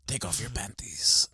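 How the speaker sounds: put-on slow seductive Spanish accent; drawn-out Spanish lover voice; thick Spanish accent. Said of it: "that thick Spanish accent".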